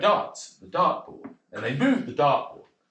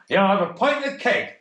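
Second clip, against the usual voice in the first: put-on northern accent; 6 semitones higher, 3 dB louder than usual. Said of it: "he did not that accent".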